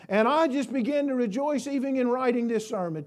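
usual speaking voice

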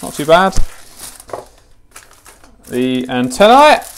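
ordinary talk

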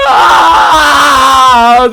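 high, loud, drawn-out scream; panicked scream. Real, not acted. Player fleeing upstairs from Granny, panicked scream. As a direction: scream while being chased. Chased in horror game.

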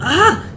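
sudden high yelp of fright; startled yelp. Real, not acted. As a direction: jump out of skin scream. Jump scare.

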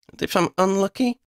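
high, nervous question; wary girl. A narrator's reading. Girl asks dragon, high wary voice. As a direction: ask despite yourself.

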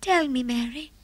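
ordinary delivery, nothing marked

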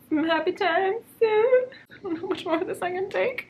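hammed-up weepy lament; mock crying. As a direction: whimper it like a fake cry. Mock despair over book.

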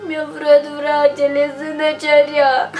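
tearful monotone whine; hammed-up weepy answer; crying. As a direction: sob out the complaint. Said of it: "Nu plânge, fetițo".

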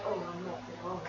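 deadpan put-on voice, voicing the pet; mock dog voice. Player voicing the glitchy dog's excuse in a low, soft mock voice. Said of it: "and you're like".

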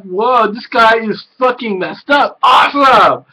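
delighted by the weirdness; excited cheer at the end; gleeful reaction. Retold or imitated. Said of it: "I'm like".

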